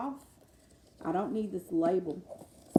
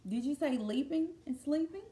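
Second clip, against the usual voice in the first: ordinary delivery, nothing marked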